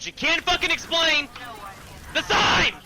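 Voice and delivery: rising to a high, loud yell; loud, high-pitched ranting frustration; exasperated outburst. Real, not acted. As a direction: build to an incredulous shout. Exasperated climax of the rant.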